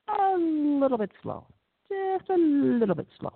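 high, stretched-out words, gliding down at the end; drawn-out slow. Shown as a demonstration. Lecturer describing kids who are just a little behind, drawn-out and high.

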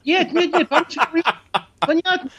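laughing Russian, pitched high; laughing protest in Russian. Shown as a demonstration. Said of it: "I speak Russian".

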